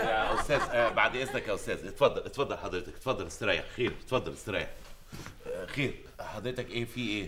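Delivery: low, soft, humoring voice; coaxing softly. Acted. Waiter humoring a twitching customer, low and soft. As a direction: soothe him like a patient.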